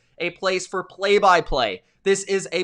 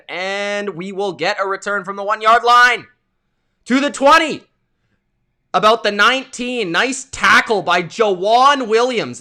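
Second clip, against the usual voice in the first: raised, punchy sports call; live play-by-play; 3 semitones higher, 6 dB louder than usual. Real, not acted. Streamer calling the opening kickoff return, live play-by-play. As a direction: call the return as it happens, building loud.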